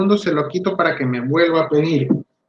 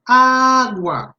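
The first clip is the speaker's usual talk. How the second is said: Agua with the a clearly said, higher, louder and drawn out; full model word; 8 semitones higher, 4 dB louder than usual. Shown as a demonstration. Therapist models the whole word for the child, stretched. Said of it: "doy el modelo".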